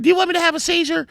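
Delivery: quick, very high accusing question; mock outraged woman's voice; mimicked indignant friend. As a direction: fire it off in falsetto, mocking her.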